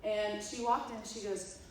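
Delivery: ordinary talk, nothing marked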